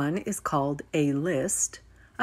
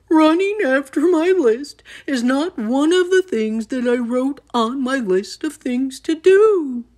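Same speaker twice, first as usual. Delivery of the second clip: loud, high-pitched complaint; wailing; 8 semitones higher, 7 dB louder than usual. A narrator's reading. Toad refusing to chase his list, wailing. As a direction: wail in loud protest. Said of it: "wailed Toad".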